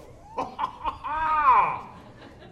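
a delighted mock laugh at the sight of the plate; giddy mock laugh. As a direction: laugh like the food is a surprise. Diner greets the same greasy dish.

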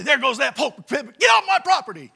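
high, loud yell; angry neighbor shout. Retold or imitated. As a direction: yell like a furious old neighbor. Imagined angry neighbors yelling.

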